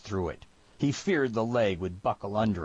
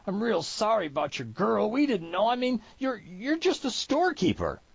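very high, stammering apology; desperate begging; 13 semitones higher than usual. A narrator's reading. Trapper apologizing at gunpoint to storekeeper, frightened pleading. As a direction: plead helplessly, voice climbing ever higher. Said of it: "he begged".

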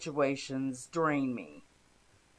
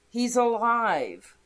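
higher-pitched, lightly raised excited call; restrained shout; 6 semitones higher, 4 dB louder than usual. A narrator's reading. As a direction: narrate a shouted announcement, held back a little. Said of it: "they shouted".

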